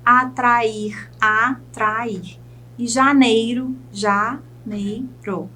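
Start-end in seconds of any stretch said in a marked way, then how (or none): 1.2-2.4 "atrair" broken into a, tra, ir; syllable by syllable. Shown as a demonstration. Teacher counts three syllables for students, "atrair" syllable by syllable. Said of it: "Eu chamo de trissílabas". 3.9-5.5 very slow "janeiro", split ja, nei, ro; syllable by syllable. Shown as a demonstration. Teacher shows three syllables to students, "janeiro" syllable by syllable. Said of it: "Eu chamo de trissílabas".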